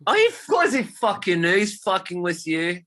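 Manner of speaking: high, loud and gleeful; real excited outburst, slowing at the end; delighted exclamation. Real, not acted. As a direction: burst out with it, thrilled by the cheek. Delighted answer to the listener.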